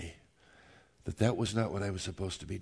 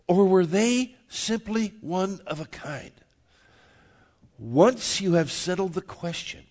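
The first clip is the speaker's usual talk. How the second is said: raised pitch, deliberate questions left hanging; rhetorical preaching; 5 semitones higher, 6 dB louder than usual. Real, not acted. Pastor asking the congregation who Jesus is, rhetorical preaching. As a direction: press each question on the listeners, pausing between.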